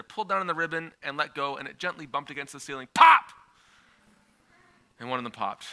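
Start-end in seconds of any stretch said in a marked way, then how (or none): 2.9-3.3 sharp high loud mock pop; sudden loud imitated pop; balloon pop. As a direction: burst out a popping noise. Balloon bursting in the story.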